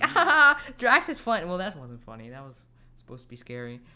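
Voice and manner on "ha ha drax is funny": high, bright mock laugh-line; sneering at the forced jokes; mock cheerful. Mocking the silly jokes in a high, loud voice. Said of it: "you have this funny".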